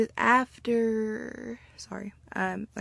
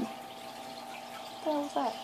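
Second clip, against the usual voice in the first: ordinary delivery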